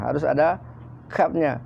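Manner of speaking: ordinary talk, nothing marked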